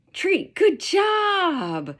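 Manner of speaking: high, loud, drawn-out cheer; excited praise. Shown as a demonstration. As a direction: sing out praise to the dog. Demonstrating praise to a dog.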